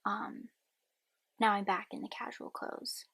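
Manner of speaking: ordinary talk, nothing marked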